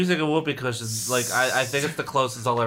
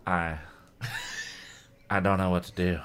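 soft, lower frightened murmur; whisper; 8 semitones lower, 7 dB quieter than usual. Acted. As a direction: barely get the words out. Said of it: "Casey managed to whisper".

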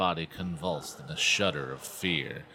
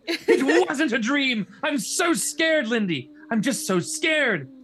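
high, loud, slow melodramatic wail; losing control; 6 dB louder, slower than usual. A narrator's reading. Chris telling her sister she's scared, hysterical wail. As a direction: wail in hammed-up terror. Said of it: "Feeling herself lose control".

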